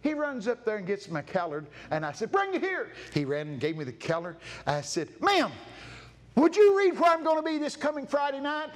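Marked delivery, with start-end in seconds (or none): 2.3-2.9 high, called command; calling out. Retold or imitated. Preacher calling to a boy to bring the calendar. 5.2-8.7 high and louder, asking politely; raised, high request. Retold or imitated. Preacher asking the woman to read his calendar aloud.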